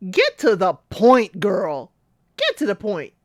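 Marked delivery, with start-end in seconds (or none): 0.1-1.9 louder, impatient call at the screen; loud urging. Real, not acted. Host urging Katisha on screen, loud impatient call. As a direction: get to the point, punched loud on get.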